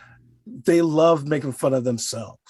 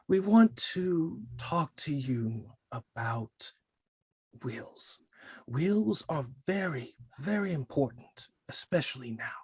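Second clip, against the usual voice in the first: warm and floaty; 8 dB quieter than usual. Shown as a demonstration. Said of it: "very warmly, very floaty almost".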